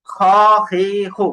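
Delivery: "খ, হে, হু" as separate breath-filled kh syllables; airy kha. Shown as a demonstration. Teacher rechecks the airflow with class, breathy kha demo. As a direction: keep air moving through the kh. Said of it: "বাতাস চালু আছে কিনা".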